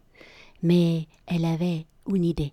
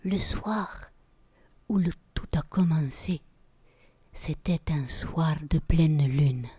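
slow whispered tale opening; epic fantasy narrator; slower than usual. Acted. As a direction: whisper it like a secret legend. Tale opens at full moon.

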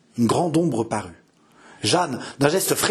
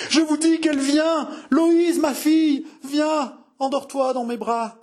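delirious mother crying out; 13 semitones higher than usual. A narrator's reading. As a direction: cry her daughter's name as if she sees her. Delirious mother insists, cries out.

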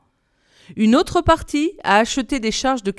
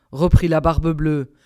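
plain reading voice

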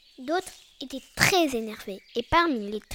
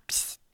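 soft, short "psst" squirt; imitated spray hiss; 5 dB quieter than usual. A narrator's reading. Lulu sprays once more, imitated hiss. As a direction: hiss it softly like a spray can. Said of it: "fit psst".

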